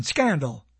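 usual speaking voice

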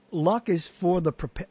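normal talking voice

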